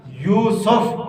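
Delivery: "یوسف" opening on a y glide; careful y at the start of "یوسف"; correct pronunciation. Shown as a demonstration. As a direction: say "Yoo-suf" for "یوسف", both syllables distinct. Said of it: "اصل نام کیا ہے".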